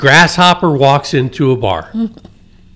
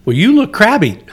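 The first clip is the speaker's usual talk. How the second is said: higher, playfully teasing imitation of Jackie; mock crabby remark; 9 semitones higher than usual. Quoting Jackie greeting him at home, higher teasing voice. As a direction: tease him like she did at the door.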